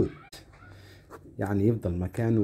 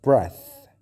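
breath said much louder, short e, hard th; loud demo; 3 semitones higher, 11 dB louder than usual. Shown as a demonstration. Teacher stresses breath against breathe, loud. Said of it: "لكن نفس".

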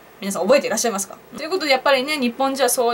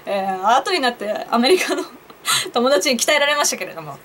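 normal talking voice

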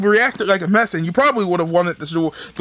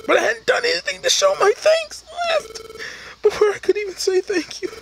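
high, pleading partner shocked at goodbye; high distraught partner voice; partner's high voice. Acted. Voices partner character in game.

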